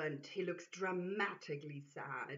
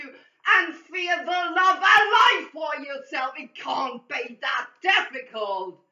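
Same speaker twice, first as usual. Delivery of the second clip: screaming; 7 semitones higher, 16 dB louder than usual. Acted. Distraction screams at the lady about love and light, furious. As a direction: scream the insult at her. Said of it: "then screaming to the lady".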